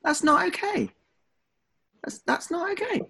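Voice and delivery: high-pitched, indignant objection; indignant protest. Real, not acted. Podcaster objects as co-host reveals their age, indignant.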